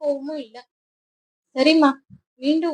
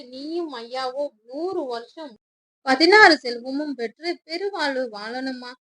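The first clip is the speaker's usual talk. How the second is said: tearful blessing; 8 dB louder than usual. A narrator's reading. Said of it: "கண்களில் நீர் கோத்து கொள்ள".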